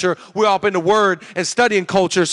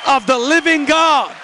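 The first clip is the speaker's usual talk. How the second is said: building, rhythmic sermon proclamation; preach; 2 semitones higher, 4 dB louder than usual. Real, not acted. Preacher proclaiming the Holy Spirit to the crowd, building preaching. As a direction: hammer each phrase home with growing force.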